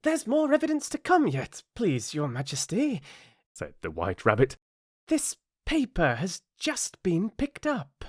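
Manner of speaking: very high, fussy rabbit voice. Acted. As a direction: squeak it out nervously.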